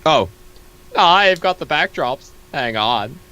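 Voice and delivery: normal talking voice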